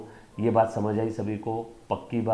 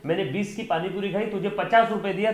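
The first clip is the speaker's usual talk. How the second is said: a hammed-up scolding of a street vendor, pitched up; mock angry; 7 semitones higher than usual. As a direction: play-act being fed up with the shopkeeper. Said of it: "तो सोचो कितना गुस्सा आएगा".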